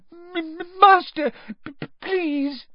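very high stammering elf voice; stammer, pleading through tears; stammering tearful elf. A narrator's reading. House-elf stammers up at her master, in tears.

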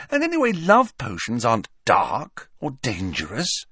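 high-voiced student explaining patiently; lightly voiced, emphatic know-it-all; Hermione. A narrator's reading. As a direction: explain it point by point, pitched high.

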